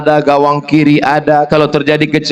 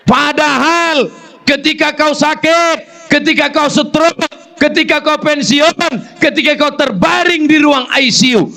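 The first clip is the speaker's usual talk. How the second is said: preaching at full force; 12 semitones higher than usual. Acted. Preacher reproaching husbands with a high, building list. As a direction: pile each clause higher.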